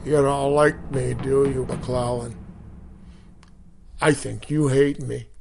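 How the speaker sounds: a hint of a sneering sergeant leaning into the ear; cooing drill sergeant. Drill sergeant taunting a recruit at his ear, mock-sweet coo. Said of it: "he cooed".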